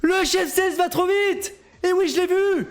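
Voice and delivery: cri aigu de commentateur, de plus en plus étiré; s'écrier, très haut, devant la faute en course; commentateur affolé. Real, not acted. Commentaire d'un dérapage en direct. (exclamation affolée, très aiguë)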